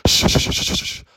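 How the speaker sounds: rapid string of shushes; hammed-up hushing; therapist shushing. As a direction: shush over the crying, acted. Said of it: "and the therapist is like".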